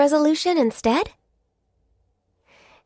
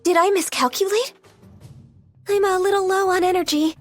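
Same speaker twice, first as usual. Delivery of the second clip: high, strained dismay; anxious mid-fight realization; worried, weakened; 6 semitones higher than usual. Acted. Low health in combat.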